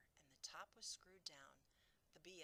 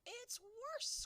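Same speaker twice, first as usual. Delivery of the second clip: much higher, louder cry; 11 dB louder than usual. Acted. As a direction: burst it out. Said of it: "cried the B F G".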